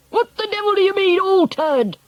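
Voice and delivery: high, fast, blustering demand; outraged shout. A narrator's reading. Father demands an explanation, outraged shout. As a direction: shout it in angry disbelief. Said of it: "shouted Mister Jenkins".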